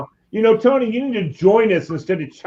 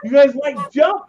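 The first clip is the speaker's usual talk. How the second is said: much higher-pitched band-leader imitation; hyping frontman; 12 semitones higher than usual. Singer asking the crowd about Jump, hyping them up. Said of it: "He goes".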